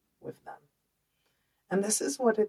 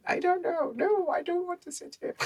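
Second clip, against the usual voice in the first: put-on much higher Dutch-accented girl's voice; a light, high Dutch accent, refusing to sit; little Dutch accent. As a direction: imitate her younger self in a Dutch accent. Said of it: "like, Dutch accent".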